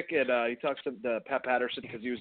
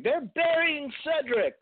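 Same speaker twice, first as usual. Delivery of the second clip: mocking whiny fans; 12 semitones higher, slower than usual.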